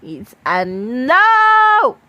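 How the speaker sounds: very slow, stretched-out "no"; drawn-out refusal. Real, not acted. Parent telling a child no, long drawn-out. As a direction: swoop up and hold the no.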